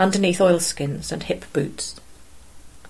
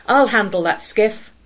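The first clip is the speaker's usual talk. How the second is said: loud, higher shout; 4 semitones higher, 5 dB louder than usual. A narrator's reading. Fisherman shouting at the boys to leave the skiff. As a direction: shout them back from the boat. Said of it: "the fisherman shouted at them".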